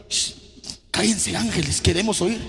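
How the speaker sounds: a low shushing God; imagined God. Retold or imitated. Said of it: "Me imagino Dios".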